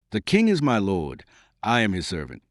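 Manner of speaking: ordinary delivery, nothing marked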